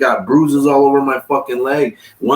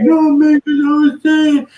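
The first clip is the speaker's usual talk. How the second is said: mocking whiny excuse; 4 dB louder, slower than usual. Cellmate excuses himself.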